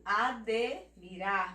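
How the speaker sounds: admirar slow, "a-dê... mirar"; name the D in Admirar instead of dji; "Admirar" split after a clear dê; consonant only. Shown as a demonstration. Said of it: "aqui só vai ter o D".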